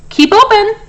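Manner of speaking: high, loud yell. A narrator's reading. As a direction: shout the order up at him. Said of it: "yelled Doctor DeSoto".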